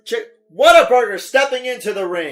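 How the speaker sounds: loud, high ringside shout; ring announcer. Pretend. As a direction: announce a fighter entering the ring. Announces contender entering the ring.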